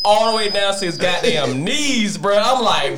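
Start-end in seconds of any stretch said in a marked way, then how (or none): none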